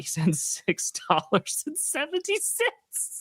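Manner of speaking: high, slow, laughing read-out; real laughter breaking up the words. Real, not acted. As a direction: read it out through helpless laughter. Amused by the donation amount.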